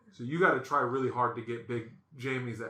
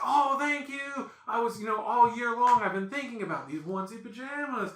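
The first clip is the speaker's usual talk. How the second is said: high, gushing mock delight; hammed-up fake gratitude; 8 semitones higher than usual. Wife opens unwanted pajamas, gushing fake delight. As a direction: overact being thrilled with an unwanted present. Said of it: "the best acting I've ever seen".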